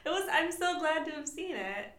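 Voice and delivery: talking through giggles; laughing. Real, not acted. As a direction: laugh while you say it.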